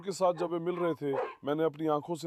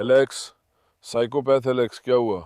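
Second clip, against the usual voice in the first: loud call across the yard, lower; calling the dog loudly; 3 semitones lower, 11 dB louder than usual. Real, not acted. Owner shouting at a dog who went wild, loud call. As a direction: shout the dog's name to stop him.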